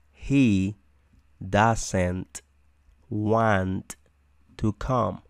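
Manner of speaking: each word set apart with pauses; teacher breaking the sentence into single words. Shown as a demonstration. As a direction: say it slowly, one word at a time. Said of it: "word by word model".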